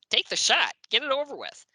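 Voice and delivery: high-pitched mock exasperation; impatient. Writer impatient with giggling photographer, high exasperated urging. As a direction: urge someone to hurry up, pitched high.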